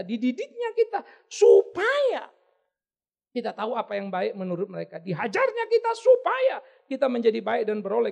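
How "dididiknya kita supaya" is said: high, loud and slow; drawn-out preaching cry. Real, not acted. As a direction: stretch each word out to the crowd. Preacher stretching the phrase. (seruan khotbah yang panjang dan keras)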